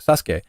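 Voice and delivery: "Sasuke" as SAS-kay, silent u; Japanese pronunciation. Retold or imitated. Streamer correcting a schoolmate, Japanese-style "Sasuke". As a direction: say "Sasuke" in two beats, ending in kay. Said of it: "in Japanese, it's".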